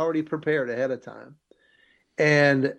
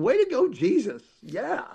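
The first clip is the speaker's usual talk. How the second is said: high, sarcastic little cheer; mock-cheer Jesus from your seat; retold cheer; 9 semitones higher than usual. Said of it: "I'm sitting there going".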